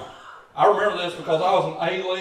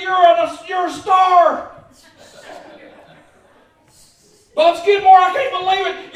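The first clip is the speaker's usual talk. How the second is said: much higher, loud shouted cheers for teammates; dugout cheering; 14 dB louder than usual. Retold or imitated. Boy in the dugout screaming for his team, very high and loud. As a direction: holler from the dugout like an excited kid. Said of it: "I'll holler and scream".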